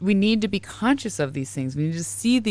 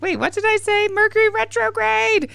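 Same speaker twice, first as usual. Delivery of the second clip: much higher, mock flustered voice; flustered self; 3 dB louder than usual. Host mocking her own flustered moment, high and flustered. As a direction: play your own panic at losing the thread. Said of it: "You could tell at the second I was like".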